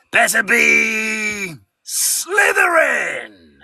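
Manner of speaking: slow, booming Sorting Hat voice. Shown as a demonstration. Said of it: "as a sorting hat".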